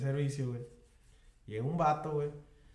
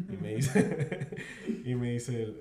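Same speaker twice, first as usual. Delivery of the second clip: ordinary talk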